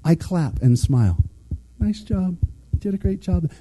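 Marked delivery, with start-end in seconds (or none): 1.8-3.5 soft, higher-pitched mock congratulations; sweetly quiet sarcasm; sarcastic praise. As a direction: praise them sarcastically, keeping it light and quiet. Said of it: "it's sarcasm".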